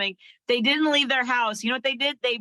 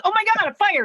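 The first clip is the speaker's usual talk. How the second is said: mock scared fire; 9 semitones higher, 4 dB louder, much faster than usual. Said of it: "the big fire goes".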